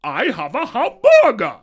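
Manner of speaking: very loud, high mock German; hammed-up German-accented English; stupid German accent. Shown as a demonstration. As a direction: blast it out in a cartoon German accent. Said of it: "putting on a stupid accent".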